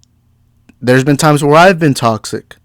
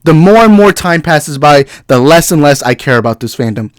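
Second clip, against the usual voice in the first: ordinary talk, nothing marked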